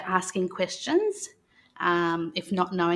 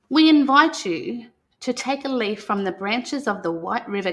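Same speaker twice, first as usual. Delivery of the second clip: put-on reading to children. [name] reads the Welcome to Country book to the children, in a calm storytelling voice. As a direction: read it aloud like a welcome ceremony to young listeners.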